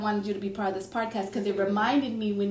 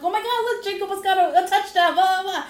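high, loud, bright pretend excitement to dodge the question; pretending to cheer to avoid the cousin's question; fake excited deflection; 11 semitones higher, 7 dB louder than usual. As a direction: gush about the touchdown to change the subject. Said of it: "I'm like".

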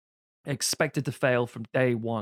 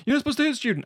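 much higher, prim mock protest; mock scandalised objection; 12 semitones higher, much faster than usual. Someone objecting to the coach hitting students, mock prim. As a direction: squeak it out like a shocked prude. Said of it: "It's like".

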